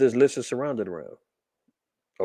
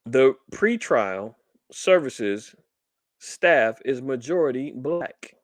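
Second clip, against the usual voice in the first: slow, spaced-out words; slow, deliberate emphasis; much slower than usual. Real, not acted. Host repeating the key fact to listeners, slow and deliberate. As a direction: spell it out slowly, pausing between phrases.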